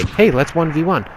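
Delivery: high, easygoing invitation; friendly high-pitched challenge; honest friendly invite. Shown as a demonstration. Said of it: "be honest and stop being a fucking dickhead".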